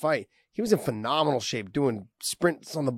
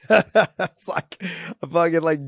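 laughing; 2 semitones higher, 4 dB louder than usual. Real, not acted. Laughing at own mispronunciation.

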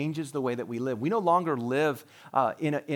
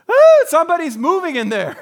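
much higher and louder startled shout; startled cry; 15 dB louder than usual. Retold or imitated. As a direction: gasp it as you spot movement. Onlooker startled at the tomb.

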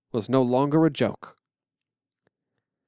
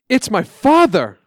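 higher, louder and slow; joyful recognition; 8 semitones higher, 8 dB louder than usual. Acted. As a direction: burst out at hearing father. Recognizing father's voice.

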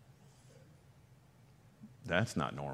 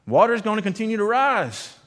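boatman calling out; 10 semitones higher, 6 dB louder than usual. Retold or imitated. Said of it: "a boat comes by the man's house and says".

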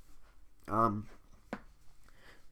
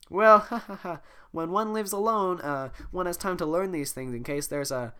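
prim princess voice; 7 semitones higher, 4 dB louder than usual. Acted. Fiona laughs, prim reply.

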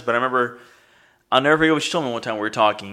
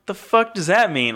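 baffled; 8 semitones higher, slower than usual. Retold or imitated. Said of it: "I'm like".

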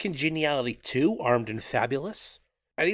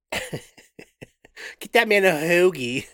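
slow Philly fan voice; 3 dB louder, much slower than usual. Pretend.

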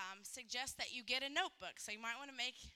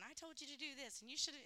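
bossy spouse quote; 4 semitones higher, 4 dB quieter, faster than usual. Retold or imitated. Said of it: "one of 'em are saying".

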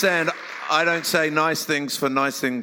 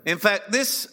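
raised, earnest sermon voice; preaching; 5 semitones higher than usual. Acted. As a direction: preach it to the congregation. Preaching through an interpreter.

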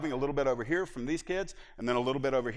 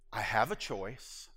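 inner thought; 4 semitones lower, 4 dB quieter, slower than usual. Retold or imitated. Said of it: "I thought to myself".

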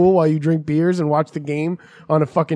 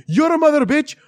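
put-on high-pitched Indian-accented rant; hammed-up Indian accent, raised voice; Indian accent impression; 10 semitones higher, 4 dB louder than usual. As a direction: imitate an angry Indian-accented driver protesting. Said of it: "I'm doing a really good impression of him".

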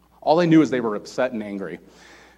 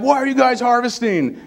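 high, indignant mock outcry; scandalized accuser, voice shooting high; freaking out; 10 semitones higher, 4 dB louder than usual. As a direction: act out an outraged objector, pitched way up. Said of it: "he's freaking out".

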